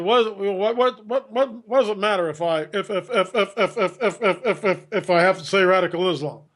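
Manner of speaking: rapid-fire stuttering imitation, stuck on repeated words; flustered Obama. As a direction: stammer helplessly before getting the sentence out. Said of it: "He's obviously flustered".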